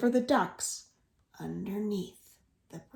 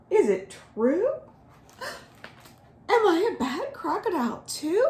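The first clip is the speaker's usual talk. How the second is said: worried Guji Guji; 9 semitones higher than usual. A narrator's reading. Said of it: "He sat by the lake to think".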